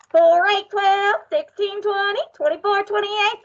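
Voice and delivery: teacher counts loudly so the office complains; loud counting. Shown as a demonstration. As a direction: make some noise with the numbers. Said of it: "this is the loud one".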